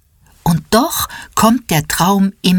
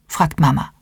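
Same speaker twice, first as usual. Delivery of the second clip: usual speaking voice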